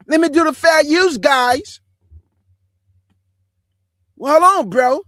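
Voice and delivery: high, loud put-on dude voice. Man redoing his fair-use notice for viewers, high loud mock dude voice.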